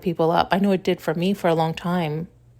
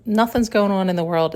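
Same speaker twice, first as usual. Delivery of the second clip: ordinary talk, nothing marked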